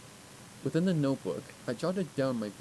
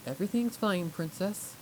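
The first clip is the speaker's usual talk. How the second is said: nervous reply; 7 semitones higher than usual. Acted. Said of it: "I replied a little too quickly".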